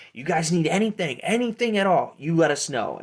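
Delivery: high-pitched halfling hustler; Pete. Acted. Halfling peddler offering his services, eager sales voice. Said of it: "He goes".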